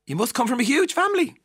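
high, loud imitated stranger; hammed-up astonished assumption; mock amazement. Stranger abroad meeting an Irish person, mock amazement. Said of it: "the fourth most asked question".

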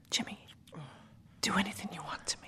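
whisper. A narrator's reading. Woman pleading with Jimmy, intimate whisper. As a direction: breathe it close.

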